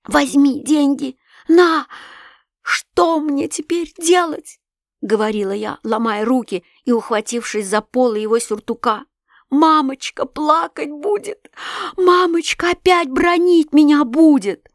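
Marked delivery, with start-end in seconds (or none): 0.0-4.6 high, slow, desperate pleading; a frightened girl begging her father; panicked begging. A narrator's reading. Terrified girl begs her father. 9.5-14.7 high, slow, anguished plea; tearful pleading. A narrator's reading. Terrified girl begs her father.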